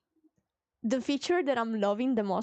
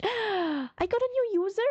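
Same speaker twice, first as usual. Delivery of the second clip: gasp of surprise; 8 semitones higher than usual. Real, not acted. Streamer spotting a new viewer, gasping surprised. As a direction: gasp and ask in real surprise.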